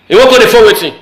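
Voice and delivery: ordinary talk, nothing marked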